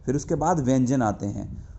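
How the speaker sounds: ordinary talk, nothing marked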